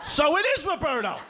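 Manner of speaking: high, pouncing accusation; mock dad catching him out; triumphant gotcha. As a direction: pounce on the slip, voice shooting up. Dad pounces on supposed confession.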